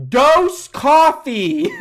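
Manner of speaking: very slow, loud, high ordering; mock tourist yell. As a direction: imitate a tourist over-enunciating at a local. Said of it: "like, yells it at him".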